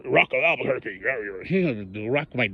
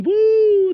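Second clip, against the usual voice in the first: mock whoop; 7 dB louder than usual. Mocking rivals' glee.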